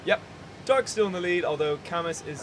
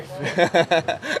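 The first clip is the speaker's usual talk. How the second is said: laughing; 2 semitones higher, 10 dB louder than usual. Real, not acted. Laughing at food listing.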